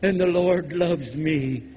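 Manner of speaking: slow, lightly voiced pious believer; pious excuse. Mocking the believer who excuses himself, lightly voiced. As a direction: play the smug believer, slowly. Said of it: "They said".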